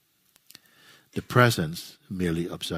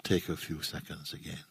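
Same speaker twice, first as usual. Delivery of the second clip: low, soft phrases, each left to settle; guided meditation; 2 semitones lower, 6 dB quieter than usual. Real, not acted. Teacher guides listeners into resting in their own presence, guided meditation. Leads a silent guided contemplation.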